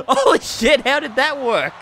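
loud, high shocked cry; shocked outburst. Real, not acted. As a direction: blurt it out in disbelief. Shocked by a wrestling spot.

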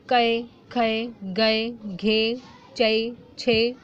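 each syllable stretched out, very slow; slow sounding-out of कै, खै, गै, घे, चै, छे; slow syllable drill. Shown as a demonstration. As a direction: drag every syllable for the kids to follow. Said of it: "क plus ए".

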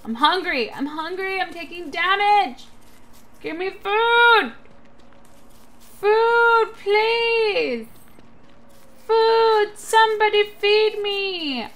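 high, loud whiny pleading; whiny begging. Acted. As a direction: beg for food, whining. Begging for food in game.

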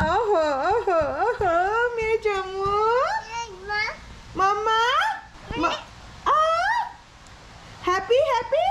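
very high playful cooing; high sing-song praise for her little one. Real, not acted. Delighting over happy child.

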